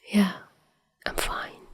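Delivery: barely audible whisper. A narrator's reading. Dazai answering the worried mother, small whisper. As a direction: whisper it, swallowing fear. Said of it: "he whispered".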